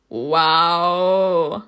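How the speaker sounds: loud, stretched-out exclamation; impressed wow. Real, not acted. Player reacts to the romantic line, loud amazed wow. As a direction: let it swell out in amazement.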